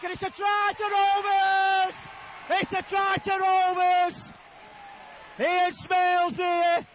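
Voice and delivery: slow, drawn-out shout of the try; excited shout. Real, not acted. Try to Rovers call.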